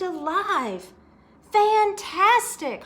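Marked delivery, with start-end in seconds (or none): 1.5-2.8 high, loud and slow with wonder; excited exclaim. Acted. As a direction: draw it out in awe. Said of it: "Hoppy exclaimed".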